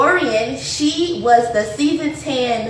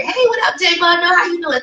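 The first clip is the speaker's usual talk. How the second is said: bright, higher hype hello; excited greeting; 7 semitones higher than usual. Real, not acted. Greeting a guest live on stream.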